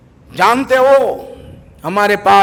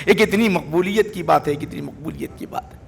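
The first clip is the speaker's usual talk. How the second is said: awed hush; 5 semitones lower, 8 dB quieter than usual. Acted. Awed aside after story.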